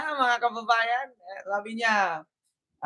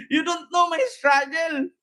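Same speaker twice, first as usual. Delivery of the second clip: high-pitched mock grievance; mock protester; 6 semitones higher than usual. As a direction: mimic her wailing complaint. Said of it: "Ano ba yung struggle niya?". (kunwaring naghihinanakit, mataas ang boses)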